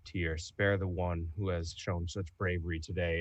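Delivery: low, soft plea to Tyr; quiet prayer. Acted. Renmore praying to Tyr for a brave companion, quietly. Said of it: "quietly say".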